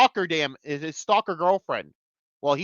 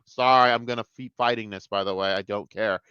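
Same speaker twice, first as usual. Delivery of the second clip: ordinary talk, nothing marked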